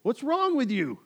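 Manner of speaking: loud, high-pitched exasperated outburst; frustrated Jesus. Acted. Jesus to his disciples, frustrated. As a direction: explode in disbelief. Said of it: "That's Jesus in this moment to his disciples".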